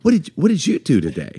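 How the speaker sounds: put-on grandmotherly question; like a grandma. Shown as a demonstration. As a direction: ask like a doting grandma. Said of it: "Like a grandma".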